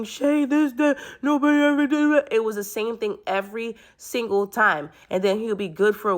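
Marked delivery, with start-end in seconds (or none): 0.0-2.3 high, whiny fake-crying plea; mock crying boyfriend. Ex coming back to cry at Shay, high mock sob. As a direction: imitate him crying and begging, pitched way up. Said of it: "do the whole crying thing".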